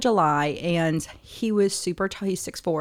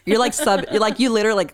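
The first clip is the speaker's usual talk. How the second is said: ordinary delivery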